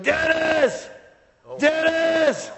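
high, drawn-out holler of a name; imitated yelling. Lady yelling in the street at dawn, imitated yell. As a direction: call it out like an angry neighbor. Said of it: "and she's yelling".

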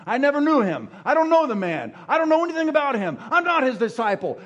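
loud, high, fast insistent denial; vehement denial. Acted. Peter insisting to accusers he never knew him, loud and heated. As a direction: deny it hotly, voice rising. Peter denying Jesus, cursing.

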